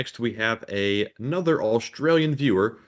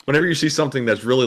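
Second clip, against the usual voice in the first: ordinary talk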